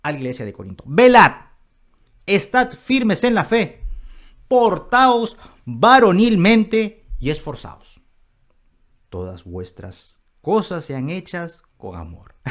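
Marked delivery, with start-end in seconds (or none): 0.9-8.0 high, loud, emphatic commands; commanding order. Acted. Apostle giving express instruction to the church, commanding. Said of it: "está dando una indicación expresa". 9.1-12.4 low, soft, tender counsel; loving gentleness. Acted. Apostle softening after his orders to the church, loving. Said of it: "Pero inmediatamente después en el versículo catorce dice".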